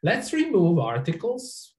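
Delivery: ordinary talk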